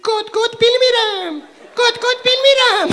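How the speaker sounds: high loud hen clucking in Azeri Turkish. Pretend. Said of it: "ترکی".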